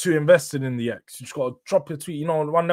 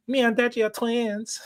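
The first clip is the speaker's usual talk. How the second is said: much higher, zesty mock voice; imitating the chatter; 11 semitones higher than usual. Streamer showing a chatter how his twins line sounded. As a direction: play the chatter in an over-the-top zesty tone. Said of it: "I was pretending to be you".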